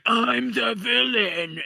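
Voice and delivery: high, slow, drawn-out villain impression; mock villain. Actor introducing his character in the movie, mock villain. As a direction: ham it up as an overacting villain. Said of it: "he's just like".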